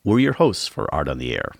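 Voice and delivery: normal talking voice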